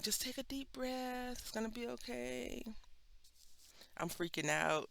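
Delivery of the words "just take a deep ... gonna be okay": calm her down gently; reassuring friend. Retold or imitated. A friend calming her on Facebook Live, soothing. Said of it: "She's like".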